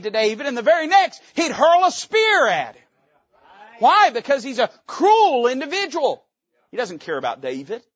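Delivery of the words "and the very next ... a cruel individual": rising higher and louder into "Why?"; building preacher shout. Acted. Preacher thunders at the congregation, rising to a shout. Preacher describes Saul's spear attack.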